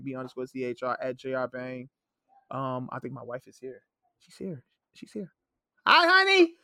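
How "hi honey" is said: loud, high call upstairs; yelling across the room. Real, not acted. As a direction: call out to someone far off. Wife arrives upstairs.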